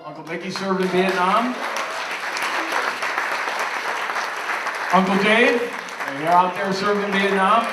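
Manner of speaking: loud, proud toast to the room; rally the room with pride; father of the bride speech. Real, not acted. Toasting family members at the wedding.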